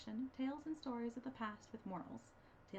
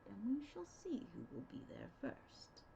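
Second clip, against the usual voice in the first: sly wolf; 3 dB quieter, slower than usual. A narrator's reading. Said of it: "said the wolf".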